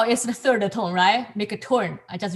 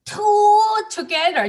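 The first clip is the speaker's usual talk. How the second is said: excited emphasis; 11 semitones higher, 5 dB louder than usual. Real, not acted. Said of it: "Uh, yeah".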